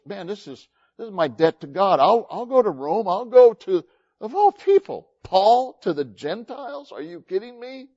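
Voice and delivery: high, animated mock disbelief; acted incredulous Paul. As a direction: build to a high-pitched acted protest. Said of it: "He goes".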